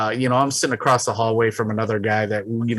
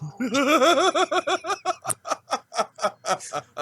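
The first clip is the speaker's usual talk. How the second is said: long high cackling laugh; retold laughing fit; 5 dB quieter than usual. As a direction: burst out laughing at the picture. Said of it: "and you just hear".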